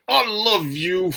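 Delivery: forced, strained "I love you" through a tight face; tense "I love you". Shown as a demonstration. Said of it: "tense your face up and just say".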